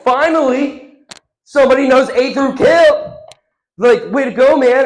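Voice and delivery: loud, high-pitched congratulation; hammed-up relief at full volume; exasperated relief. Supervisor praising the one who knows Kip, loud relief.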